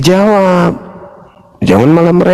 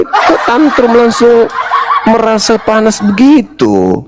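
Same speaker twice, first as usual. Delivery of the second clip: teasing, slower and higher, with a laugh; laughing taunt; 6 semitones higher than usual. Real, not acted. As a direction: needle him while laughing. (mengejek sambil tertawa)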